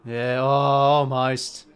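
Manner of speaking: loud, slow, stretched-out groan of a near miss; drawn-out almost. Real, not acted. Fan watching a kick just miss, loud slow groan. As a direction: let the almost sag out long and loud.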